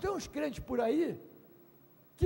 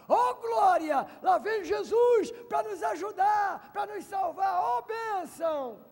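high joyful cry of relief; excited shout; 6 semitones higher, 4 dB louder, slower than usual. Acted. Disciples hailing Jesus on the water, excited shouting. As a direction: shout for joy as rescue comes. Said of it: "o que que eles falaram?".

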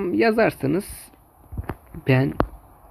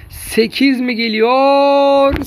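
drawn-out, louder, higher call; excited; 8 semitones higher, 5 dB louder, much slower than usual. Acted. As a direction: stretch it out with rising hype. Building excitement for eighth goal.

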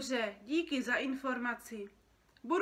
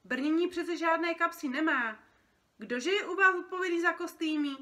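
loud, higher-pitched irate phone complaint; indignant complaint; 7 semitones higher, 9 dB louder than usual. Acted. Feathered creature complaining by phone about costumes, indignant and loud. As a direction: demand answers down the line, exasperated. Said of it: "Stěžoval si jiný opeřenec".

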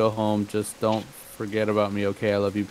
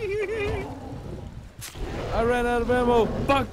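panicking gamer. Real, not acted. Caught by monster, out of ammo.

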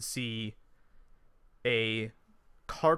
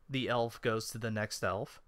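usual speaking voice

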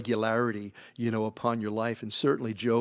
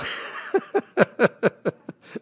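high airy laugh at own joke; breathy laugh; 11 semitones higher, 5 dB louder than usual. Real, not acted.

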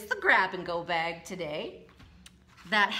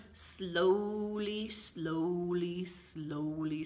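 drawn-out sleepy sloth voice, fading softer; super slow sleepy sloth; 3 semitones lower, 4 dB quieter, much slower than usual. A narrator's reading. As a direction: let each slowly sag softer and sleepier. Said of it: "said the sloth".

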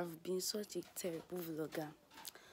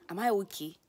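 retold worried self-question; 2 semitones higher, 11 dB louder than usual. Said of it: "I'm like".

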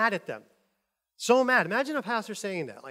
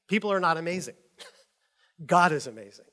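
usual speaking voice

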